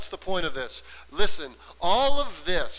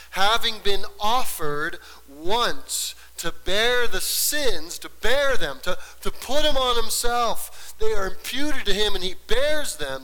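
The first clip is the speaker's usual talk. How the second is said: loud, high, insistent preacher voice; forceful preaching; 4 semitones higher, 5 dB louder than usual. Real, not acted. Preacher expounds Hebrews on bearing sins, forceful preaching. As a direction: build hard on each repeated phrase.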